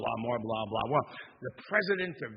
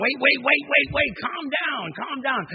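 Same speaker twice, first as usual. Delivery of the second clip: loud, much higher wait wait wait, slowing into calm down; frantic shout; 11 semitones higher, 12 dB louder than usual. Retold or imitated. President shouting to calm the burly train worker. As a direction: yell to stop the brawl.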